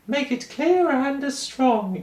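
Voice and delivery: "Make it clear and strong" in high, slow falsetto. Shown as a demonstration. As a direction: speak it high in head voice. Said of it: "in your head voice?".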